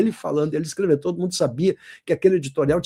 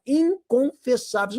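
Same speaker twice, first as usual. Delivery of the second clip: INCONFESSÁVEIS high and drawn out; slow, raised, dramatic "inconfessáveis"; dramatic stress; 7 semitones higher, slower than usual. Shown as a demonstration. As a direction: stretch "inconfessáveis" syllable by syllable. Teacher dramatizing loaded adjective.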